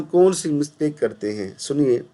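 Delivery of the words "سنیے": normal talking voice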